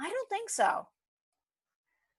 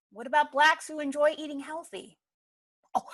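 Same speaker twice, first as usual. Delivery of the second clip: usual speaking voice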